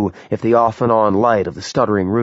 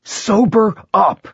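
slow, much higher, louder furious scream; 12 semitones higher, 4 dB louder, slower than usual. A narrator's reading. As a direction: scream it into her face. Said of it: "he screamed into her frightened face".

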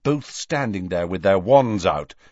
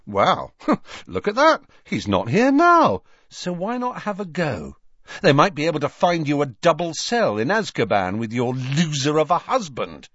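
higher-pitched taunt with a scoffing laugh; derisive, raised-pitch challenge; mocking; 7 semitones higher than usual. A narrator's reading. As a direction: goad an enemy mockingly. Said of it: "Harry looked mockingly all round the shop".

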